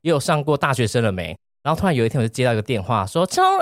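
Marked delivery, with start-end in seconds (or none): 3.3-3.6 grandma voice, pitched much higher; imitated grandma. Grandson retells grandma's phone call, higher imitated grandma voice. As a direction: play grandma calling out your name on the phone, higher.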